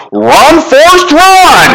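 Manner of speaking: high, loud "Run, Forrest" bellow; mock shout. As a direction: belt it like the movie line, laughing. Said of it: "she looks at me and she says".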